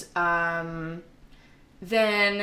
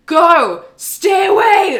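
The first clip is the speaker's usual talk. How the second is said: much higher, loud desperate yell; urgent shout; 11 semitones higher, 13 dB louder, much slower than usual. Retold or imitated. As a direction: scream at him to get away. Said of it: "she's like".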